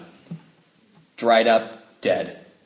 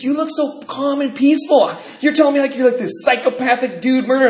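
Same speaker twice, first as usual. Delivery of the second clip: incredulous guys; 9 semitones higher than usual. Retold or imitated. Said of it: "looking at me going".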